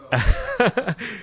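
high-pitched chuckling laugh; amused laugh. Real, not acted. Teacher delighted by the story's turn, laughing.

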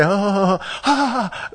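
high "oh, ah" exclamations; acted onlooker cries. Imitating demigods watching the fight, high drawn-out oohs. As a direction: imitate awed spectators, pitched high.

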